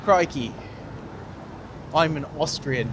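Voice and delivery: badly faked Aussie passed off as Austrian; hammed-up Australian twang; mock Australian accent. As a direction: do a broad, jokey Australian accent. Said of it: "Brilliant accent".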